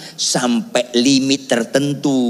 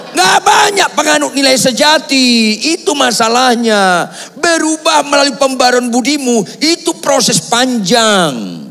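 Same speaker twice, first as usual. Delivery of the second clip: high, loud shouted exhortation; full-force preaching; 12 semitones higher, 5 dB louder than usual. Real, not acted. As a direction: preach it at the top of your voice.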